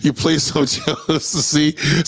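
laughing through the words, slow. Real, not acted. Comic cracking up at his own Jodeci joke, laughing. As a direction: crack up while saying it.

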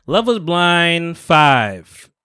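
slow, loud, drawn-out show title; dramatic title drawl. Acted. Podcast host hyping the show name, slow and loud. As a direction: announce it like a TV host.

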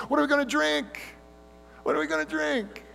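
put-on high, whiny childish complaint; whiny children. Israelites griping to Moses in the desert, high childish whine. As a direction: whine it like a spoiled kid. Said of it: "they sounded often like children".

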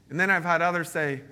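ordinary delivery

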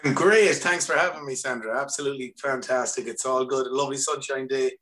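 normal talking voice

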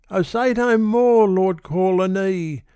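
put-on high, loud, pleading young woman; a high plea, "oh" stretched and falling; Mabel pleading. A narrator's reading. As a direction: protest high and breathless, like a flustered girl. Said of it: "she said".

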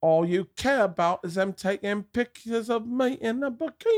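high-pitched mock Australian actress; whiny Australian complaint, badly faked; horrible Australian accent. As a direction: do her in a bad Australian falsetto. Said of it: "doing that horrible accent as her".

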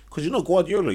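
heated objection. Real, not acted. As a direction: hit not Guardiola with force. Heated rant about the manager.

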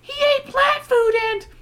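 put-on high girl's voice. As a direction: voice young Margaret up high. Margaret voiced in story.

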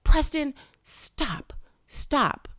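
high sharp call then a whispered stop; hissed warning. Retold or imitated. Mother hushing her toddler at a graveside, urgent hiss. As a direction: warn the child under your breath. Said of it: "I'm like".

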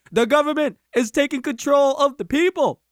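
lightly voiced Obama cadence; a hint of presidential speech; Barack Obama impression. Speaker on a crate addressing the crowd, light Obama impression. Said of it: "Was that your Barack Obama thing".

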